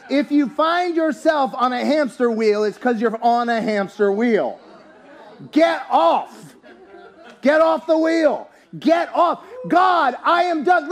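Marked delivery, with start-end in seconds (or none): 5.5-10.9 preaching shout. Real, not acted. Preacher shouting to his congregation to get off. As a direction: shout them off the wheel.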